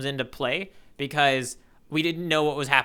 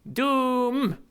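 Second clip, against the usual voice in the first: ominous; 9 semitones higher than usual. Acted. Dramatic reading of panel sound effect.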